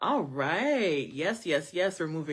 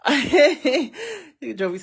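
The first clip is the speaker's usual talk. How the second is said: laughing; 10 semitones higher, 10 dB louder than usual. Real, not acted. Laughing at viewer comment.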